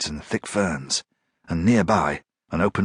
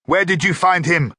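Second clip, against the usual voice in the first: high, loud demand; 10 semitones higher, 4 dB louder than usual. A narrator's reading. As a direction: demand an answer. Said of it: "demanded the newcomer".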